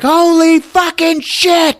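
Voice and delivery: high, loud shriek of shock, drawn out; hammed-up scream of fright; scared shout. Annie sees the glass move, shrieking in shock. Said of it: "It scares the shit out of her".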